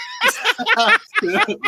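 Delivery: loud, high-pitched laughter. Real, not acted. Guest after claiming he won in court, high laugh.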